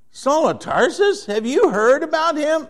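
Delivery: alarmed disbelief. Retold or imitated. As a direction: gasp out the name in disbelief. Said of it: "And he goes".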